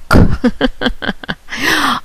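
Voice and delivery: a short, high, teasing laugh; high mocking laugh. Real, not acted. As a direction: laugh down at him, amused. Mocking her slave, laughs.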